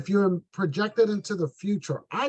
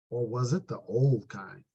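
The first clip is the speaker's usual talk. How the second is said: put-on crunchy old kind; 8 semitones lower, 4 dB quieter than usual. Shown as a demonstration. Agent makes old coverage sound crunchy, low and slow. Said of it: "Sounds kinda crunchy".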